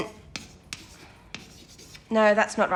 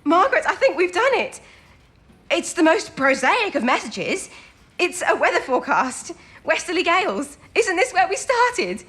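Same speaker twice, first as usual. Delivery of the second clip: higher, bursting with joy at the breakthrough; triumphant excitement, pitched up and ringing; elated discovery; 8 semitones higher than usual. Acted. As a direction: call out to Margaret, thrilled. Codebreakers crack the code.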